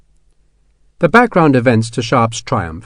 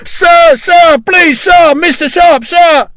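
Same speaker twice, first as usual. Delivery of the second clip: frantic begging; 8 dB louder, much slower than usual. Acted.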